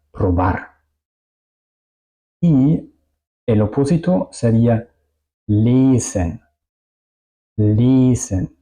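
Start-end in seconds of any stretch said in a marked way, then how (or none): 5.5-8.6 lesen with a long, held "ee"-like first vowel. Shown as a demonstration. Teacher models lesen twice, long vowel. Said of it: "La I suena muy larga".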